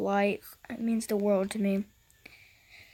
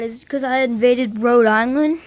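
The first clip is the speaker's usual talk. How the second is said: normal talking voice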